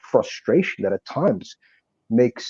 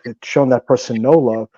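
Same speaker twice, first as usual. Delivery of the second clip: ordinary talk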